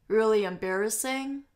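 "embarrassing" dips, then rises at the end; fall-rise tune. Shown as a demonstration. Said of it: "它用的是先降后升这样子".

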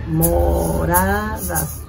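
Moradas in three slow beats; syllable by syllable. Shown as a demonstration. Teacher rechecking the count, Moradas syllable by syllable. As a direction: draw out Mo-ra-das evenly. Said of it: "contar las sílabas".

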